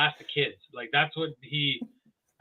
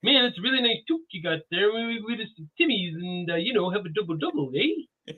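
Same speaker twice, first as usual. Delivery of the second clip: Canadian impression; 3 semitones higher than usual. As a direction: do a polite, chatty caricature of a Canadian. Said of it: "hear him do a Canadian impression".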